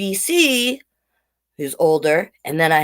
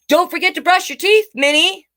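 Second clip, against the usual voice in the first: lightly voiced parent reminder, a bit higher; a gentle nudge from dad; dad's reminder; 12 semitones higher than usual. Reading the dad's line to the child listeners, lightly voiced.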